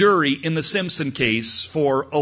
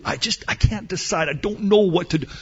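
high, hurried mock helplessness; flustered indecision; 5 semitones higher, faster than usual. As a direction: act out someone who can't make up their mind. Passenger pressed again by captain.

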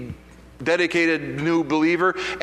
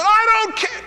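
very high, loud defiant shout; yelling back; 5 dB louder, slower than usual. Retold or imitated. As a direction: snap back at the boss, full volume. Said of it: "And I start yelling at him".

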